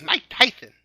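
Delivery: lisping boxer impression; awful lispy celebrity impression; Mike Tyson. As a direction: do the famous boxer with a lisp. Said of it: "an imitation of a guy".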